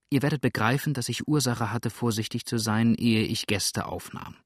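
usual speaking voice

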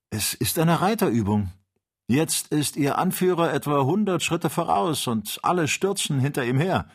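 aufgeregter Späherbericht, höher gesprochen. A narrator's reading. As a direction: melde gespannt, was du siehst. Kennedy reports riders seen. (aufgeregt meldend)

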